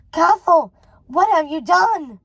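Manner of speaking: loud, high shocked cry; horrified cry. A narrator's reading. Mother shrieking as the conservatory burns. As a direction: scream out in disbelief.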